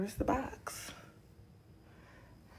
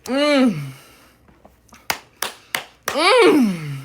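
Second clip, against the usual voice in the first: loud high mm of taste; savoring hum; 6 semitones higher, 7 dB louder than usual. Real, not acted. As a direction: hum with pleasure. Savoring cereal.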